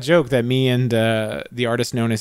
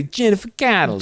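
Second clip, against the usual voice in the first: higher playful Brooklyn name; Brooklyn accent; 7 semitones higher than usual. Retold or imitated. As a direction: savor the name Brooklyn style. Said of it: "'Cause it's fun to say".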